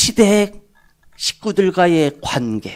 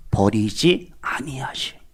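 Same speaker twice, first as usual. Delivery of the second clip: hushed and slow, lower, breathy intimacy; whisper; 9 semitones lower, 6 dB quieter, slower than usual. Real, not acted. Preacher assuring the congregation in a slow whisper. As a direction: let the promise fall to a whisper.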